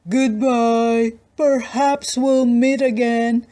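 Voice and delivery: a sly farewell; wolf voice. Acted. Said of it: "replied the wolf".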